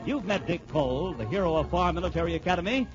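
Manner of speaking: normal talking voice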